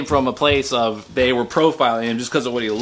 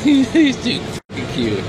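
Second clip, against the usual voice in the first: gushing to viewers about her dog in a higher voice; higher, slower gushing pet voice; 12 semitones higher, slower than usual. Real, not acted. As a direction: overcome with cuteness, higher.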